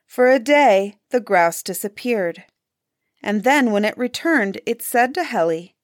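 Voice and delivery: ordinary delivery, nothing marked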